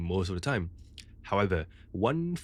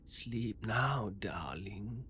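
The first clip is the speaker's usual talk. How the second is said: put-on soft, breathy old woman whisper; shaky frail old lady; 8 dB quieter, much slower than usual. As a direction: croon it like a frail grandmother. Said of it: "she spoke in this very shaky and frail voice, much like an old lady".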